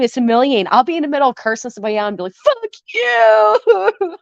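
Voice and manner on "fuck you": high, teary mock-sob curse; mock angry crying. Streamer imitates herself cursing someone out while angry-crying, high mock sob. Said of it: "I angry cry all the time".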